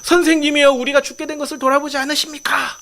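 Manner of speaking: high-pitched acted cry for help; frightened plea. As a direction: plead like panicked disciples waking their teacher. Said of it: "두려웠어요". (겁에 질려 높은 목소리로 애원)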